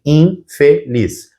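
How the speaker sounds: "infeliz" slowly split as in-fe-liz; syllable by syllable. Shown as a demonstration. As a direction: separate each syllable of "infeliz" with a small gap. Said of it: "porque vai dizer assim".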